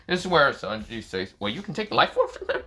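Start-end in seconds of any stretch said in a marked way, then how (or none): 1.4-2.6 acted astonished query; surprised question. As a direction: voice climbing very high. Sanji reacts to the doll's claim.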